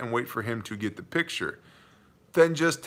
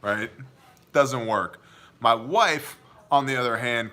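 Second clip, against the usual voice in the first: ordinary talk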